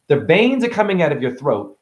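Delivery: normal talking voice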